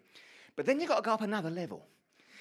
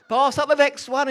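loud, high repeated call down; panicky call; 9 semitones higher, 14 dB louder than usual. Retold or imitated. Asking his wife for the next plank while frightened, loud high call. Calling down from scaffold, scared.

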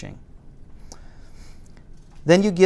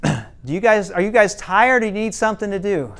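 put-on raised, higher call up to the kids; yelling up the stairs; 6 semitones higher, 3 dB louder than usual. Parents on the couch call up to noisy teens, raised voice. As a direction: call it up the stairs, half-yelled. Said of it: "yell up the stairs".

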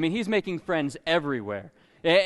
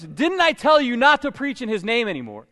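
louder, higher, scolding a fisherman; angry high priest; 5 semitones higher, 7 dB louder than usual. Acted. High priest confronting Peter, loud and accusing. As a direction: jab the question at him like an offended boss. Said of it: "he was up in his".

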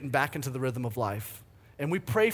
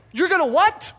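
high, loud disbelieving shout; can't believe what she just said; incredulous; 13 semitones higher, 11 dB louder than usual. Retold or imitated. Husband hearing she wants a shower, shouting in disbelief. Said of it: "I'm like".

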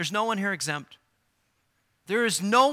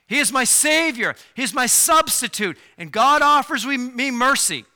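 louder and higher, building; fervent preacher's appeal; full-force preaching; 5 semitones higher, 8 dB louder than usual. Acted. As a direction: urge them toward the altar. Preaching call to repentance.

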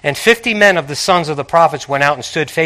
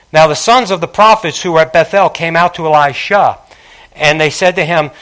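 ordinary talk

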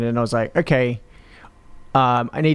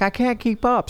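whiny high NPC; 11 semitones higher than usual. Retold or imitated. NPC whining behind horse.

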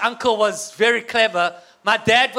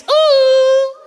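very high, loud, long swooning ooh; ladies' ooh; 10 dB louder than usual. Shown as a demonstration. Speaker prompts ladies to join in, high swooning ooh. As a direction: ham up a shrieky admiring ooh.

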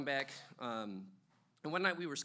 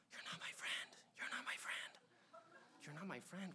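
soft, breathy mock whisper; imitating whisper; 3 semitones higher, 13 dB quieter than usual. Imitating the drunk girlfriend whispering to him. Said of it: "she starts whispering".

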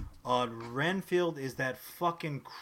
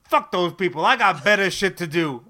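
louder mock brush-off; tossed-off, louder dismissal; mock dismissive; 9 dB louder than usual. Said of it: "he legitimately said".

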